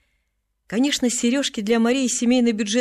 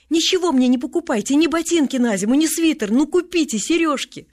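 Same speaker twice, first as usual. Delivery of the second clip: put-on high, pleading child's outburst; insistent begging; 6 semitones higher, 4 dB louder than usual. A narrator's reading. Girl begs her family for earrings, high and insistent. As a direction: plead in a raised, pressing voice, piling up the list.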